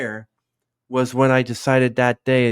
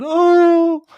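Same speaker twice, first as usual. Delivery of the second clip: a high, loud, tearful "Oh"; mock crying; 9 dB louder than usual. Retelling her crying scene, a high tearful cry. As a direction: cry out the "Oh" like breaking into tears. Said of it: "I was crying and said".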